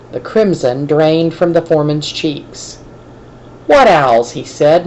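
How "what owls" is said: ordinary delivery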